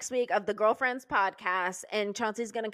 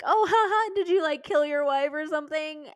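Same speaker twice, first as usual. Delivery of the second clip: high-pitched, faux-casual haha question; mock-joking question; 5 semitones higher than usual. Acted joking question to the man.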